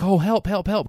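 high panicked plea for help, lightly acted; imitating a desperate woman calling help; mock cry for help. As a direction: squeal help help help like a frightened aunt. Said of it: "She's like".

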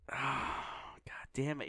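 whispered exasperated curse; frustrated whisper. Real, not acted. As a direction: hiss the curse under your breath. Exasperated curse under breath.

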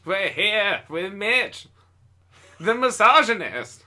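forties announcer. Pretend. Host introducing Mitch in a forties radio voice. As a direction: stretch it like a vintage announcer. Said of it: "I just talk like a dude from the forties".

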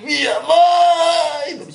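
very drawn-out, high holler; joking shout. Pretend. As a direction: holler it out with endlessly stretched vowels. Jokey shouted answer to "who?.

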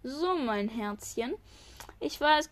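plain reading voice

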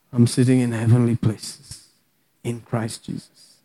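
soft, low, drifting away; hushed imagining. Shown as a demonstration. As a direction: say it softly as if with eyes closed. Models imagining with eyes closed.

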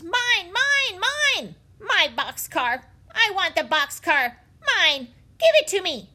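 put-on loud, high child's tantrum scream. A narrator's reading. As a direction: scream it like a spoiled little girl. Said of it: "she screamed".

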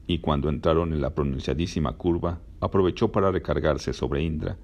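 ordinary delivery

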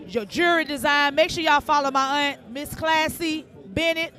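low, even announcer shout-outs; steady radio host read. Acted. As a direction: roll through the shout-out list like a DJ. Reads a run of shout-outs.

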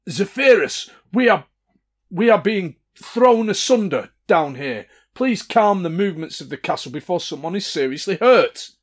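theatrical plea to the castle's master; dramatic giant's voice. Acted. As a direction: boom it out like a magically amplified giant. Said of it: "Very dramatic way of saying it".

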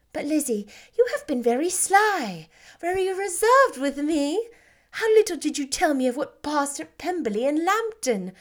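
teasing Lizzie for being sly; Jane, fond then reproachful. Acted.

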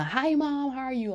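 put-on high bright girl's hello; perky daughter. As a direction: imitate a cheerful daughter, pitched high.

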